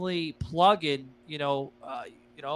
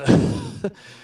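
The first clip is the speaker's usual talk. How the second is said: laughing; 6 dB louder than usual. Real, not acted. Laughing at big number.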